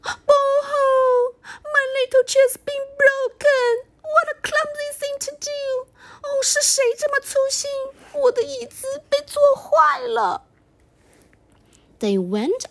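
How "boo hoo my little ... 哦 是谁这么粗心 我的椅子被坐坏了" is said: very high, loud, whimpering cub; crying baby bear. Acted. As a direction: sob it out like a tiny cub. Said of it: "Baby bear said".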